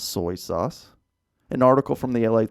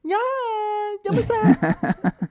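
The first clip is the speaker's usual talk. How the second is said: very high, stretched Yamasa with a laugh; squeaky high Yamasa. Pretend. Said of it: "You got".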